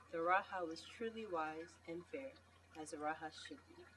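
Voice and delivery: ordinary delivery, nothing marked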